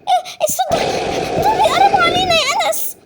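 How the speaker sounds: put-on high girl's voice, alarmed; frightened sister. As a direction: hear the zombies coming and panic. Zombies approaching.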